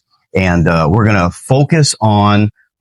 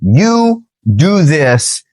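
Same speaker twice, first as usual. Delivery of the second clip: slow, higher-pitched order, each word separate; commanding; 4 semitones higher, much slower than usual. Acted. Preacher voices the order given to the cavalry, slow and commanding. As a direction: issue a deliberate military order. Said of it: "They were commanded".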